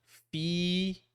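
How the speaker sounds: fi with a long high E. Shown as a demonstration. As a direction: draw out the E in fi. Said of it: "long kasra, so E".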